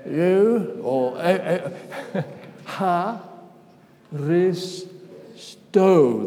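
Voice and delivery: You, ris, sto split into separate syllables, stress landing loud on sto; syllables spelled out. Shown as a demonstration. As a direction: break it into four syllables and punch the last one, sto. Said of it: "The emphasis is on the last syllable".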